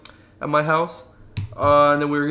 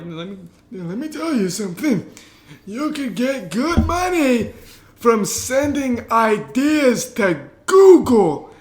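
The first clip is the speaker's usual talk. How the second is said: put-on much higher, slow, drawn-out old man's advice; old neighbor impression; 7 semitones higher, 5 dB louder, much slower than usual. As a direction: stretch it out slowly like an old man sharing a secret. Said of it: "there's two different voices that just happened here".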